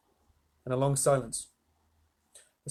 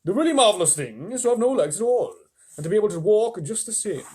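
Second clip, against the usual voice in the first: much higher, louder earthworm; 7 semitones higher, 6 dB louder than usual. Acted. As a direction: pronounce it high and lofty. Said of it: "the earthworm said".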